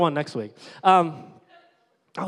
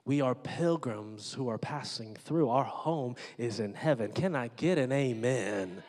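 ordinary talk

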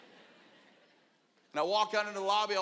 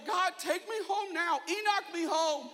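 higher, slow pleading to God; mock desperate plea; 9 semitones higher, slower than usual. Embarrassed bus driver begging God to rapture him, hammed-up. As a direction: beg the Lord to take you now. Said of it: "Just pleaded with the Lord".

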